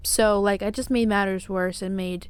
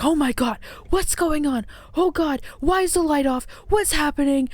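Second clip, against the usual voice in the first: higher-pitched mock alarm of someone jolted awake; startled alarm, imitated; 5 semitones higher than usual. Partner waking up out of his sleep, imitated startled alarm. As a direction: act out confused, urgent questions on waking.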